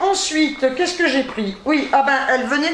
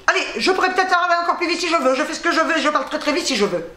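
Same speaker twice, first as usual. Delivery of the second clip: a show of talking even faster, tongue racing; speeding up on purpose; 4 semitones higher, much faster than usual. Shown as a demonstration. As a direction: rattle it off very fast with a laugh. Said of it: "mais j'ai qu'à parler encore plus vite".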